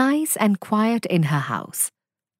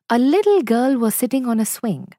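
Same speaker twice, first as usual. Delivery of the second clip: ordinary delivery, nothing marked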